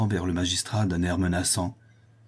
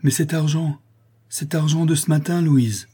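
high, urgent demand; agitated and raised in pitch; alarmed exclamation; 8 semitones higher, 5 dB louder than usual. A narrator's reading. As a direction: cry out with sudden suspicion. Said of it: "Morel s'écria".

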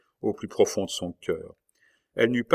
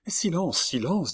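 higher, hushed silencing; urgent hush; 9 semitones higher than usual. Acted. Marguerite hushing la Mole so no one hears. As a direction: stop him talking, high and tense.

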